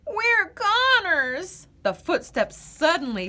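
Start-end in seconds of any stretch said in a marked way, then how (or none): 0.1-1.7 high, slow dramatic wail; hammed-up despair. Goose despairs while hiding, high and slow. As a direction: wail it like all is lost.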